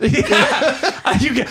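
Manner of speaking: normal talking voice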